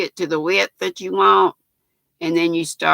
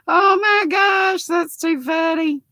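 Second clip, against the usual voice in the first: delighted squeal; 9 semitones higher than usual. Real, not acted. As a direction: squeal it out like the story really got you. Amused by a viewer's comment.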